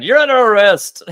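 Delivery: hammed-up stern arrest call; mock arrest shout. Captain arresting Perrin.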